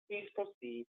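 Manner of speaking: normal talking voice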